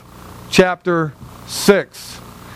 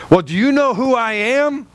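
haughty; 6 semitones higher than usual. Retold or imitated. Preacher mimicking a proud member with haughty scorn. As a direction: play the puffed-up member who expects deference. Arrogant church member demanding respect.

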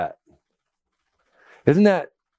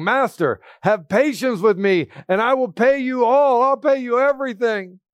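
rising, begging plea from a servant on his knees; a servant's mock-pleading cry; 8 semitones higher than usual. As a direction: beg the master for more time.

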